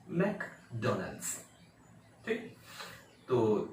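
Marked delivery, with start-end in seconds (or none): 0.1-1.4 मैकडोनल्ड्स slow, "mek... DO-nals"; correct McDonald's pronunciation. Shown as a demonstration. Teacher repeats the right version, slow pronunciation. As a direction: say मैकडोनल्ड्स slowly in two beats. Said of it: "ऐसे बोलते हैं".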